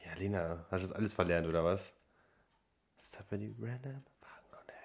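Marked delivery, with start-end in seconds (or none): 3.0-4.7 hushed prompting whisper. Real, not acted. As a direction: whisper it to her secretly. Unvoiced whisper. (Flüstern)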